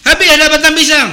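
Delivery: a loud mock cry of dismay; outraged cry. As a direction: blurt out that the food is gone. Guest finds food gone, outraged.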